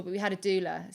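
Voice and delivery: ordinary delivery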